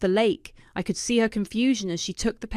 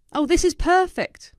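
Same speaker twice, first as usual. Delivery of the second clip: excited; 10 semitones higher, 7 dB louder than usual. A narrator's reading. Maya gleeful at the footage.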